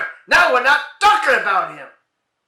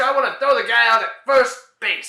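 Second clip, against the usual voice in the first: normal talking voice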